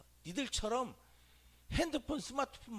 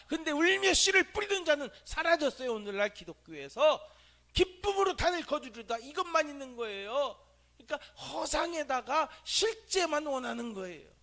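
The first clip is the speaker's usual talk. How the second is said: loud, high, urgent preaching; fiery preaching; 8 semitones higher, 8 dB louder than usual. Real, not acted. Preacher rebuking the churchgoers who skip the hard part, loud and high. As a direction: rouse the congregation.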